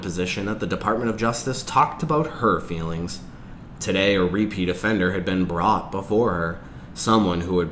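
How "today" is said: ordinary delivery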